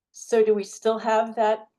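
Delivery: usual speaking voice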